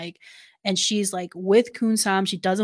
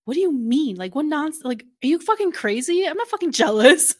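higher, mock-outraged protest; indignant denial, retold; 7 semitones higher, 4 dB louder than usual. Kun-sam denies being jealous, acted indignation. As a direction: get louder and more incredulous by the end.

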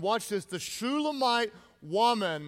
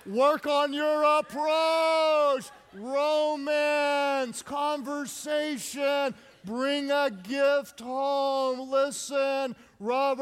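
emphatic preaching; 4 semitones higher, much slower than usual. Acted. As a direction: drive it home from the pulpit. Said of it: "preaching at full force".

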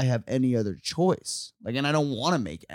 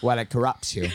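imitating British lady. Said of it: "It was like Michael Caine, but a woman".

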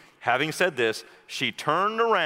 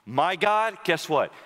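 ordinary talk

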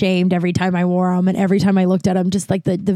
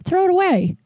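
friend's alarmed voice; 9 semitones higher than usual. Retold or imitated. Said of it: "And she goes".

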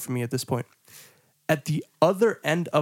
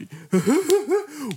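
high mocking laugh, imitating the guys; imitated friends' laughter; 12 semitones higher than usual. Imitating friends who watched him flee, high teasing laughter. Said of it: "These dudes are like".

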